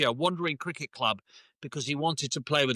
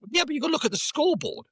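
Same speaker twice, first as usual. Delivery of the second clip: fast, much higher, pushing back at the interviewee; exasperated retort; 13 semitones higher, faster than usual. Retold or imitated. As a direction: retell your own impatient comeback, quick and high. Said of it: "And I'm like".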